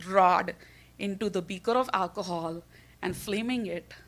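usual speaking voice

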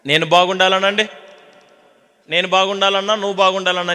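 ordinary talk, nothing marked

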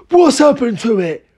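high, agitated question; alarmed cry. A narrator's reading. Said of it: "cried Mister Killycranky".

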